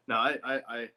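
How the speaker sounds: ordinary talk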